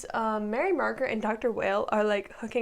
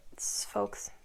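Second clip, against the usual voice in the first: whisper; 4 semitones lower, 7 dB quieter than usual. Real, not acted. Aside after the sad scene.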